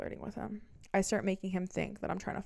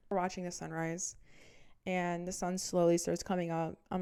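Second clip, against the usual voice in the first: ordinary talk, nothing marked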